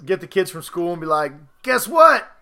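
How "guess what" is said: higher, louder announcing; much higher, louder excited reveal; mock excited news. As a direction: break the big news to the kids. Telling kids they're rich.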